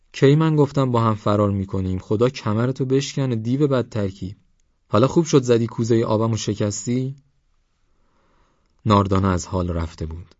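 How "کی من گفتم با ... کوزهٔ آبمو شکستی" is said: raised, bitter cursing; cursing girl. Acted. Girl curses the young man, angry. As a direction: curse him for the broken jug.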